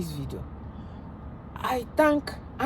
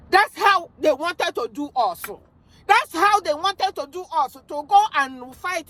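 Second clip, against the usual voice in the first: loud, outraged declaration; outraged shout; 5 semitones higher, 4 dB louder than usual. Real, not acted. Vlogger shouting to Nigerians about the war plan, outraged. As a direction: shout it out in protest.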